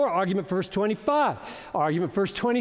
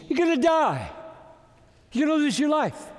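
rising warning; 8 semitones higher than usual. Real, not acted. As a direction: lift the voice and warn hard. Preacher warning of death.

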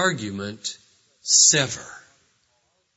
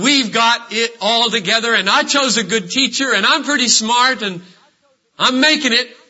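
louder, higher, fast boasting bravado; mock self-assured boast; 9 semitones higher, 8 dB louder than usual. Insecure boaster reassuring himself, in a loud mock swagger. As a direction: puff yourself up to hide the fear. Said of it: "touting our own self-sufficiency".

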